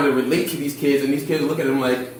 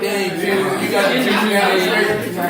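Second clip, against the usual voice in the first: laughing excitedly; 6 semitones higher, much slower than usual. Real, not acted. Excited about the film Juice.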